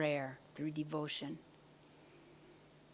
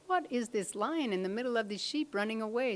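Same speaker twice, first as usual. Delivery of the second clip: lightly voiced, higher-pitched puzzled lion; 6 semitones higher than usual. Pursuing lion spotting a lion among sheep, lightly voiced puzzlement. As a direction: wonder aloud in a raised pitch. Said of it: "the lion in pursuit says".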